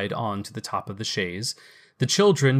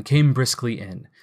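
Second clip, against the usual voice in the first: usual speaking voice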